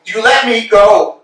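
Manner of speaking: high, loud, slow accusation; reproachful shout. Acted. Estragon accuses Vladimir of abandoning him, shouting. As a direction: hurl the blame at him.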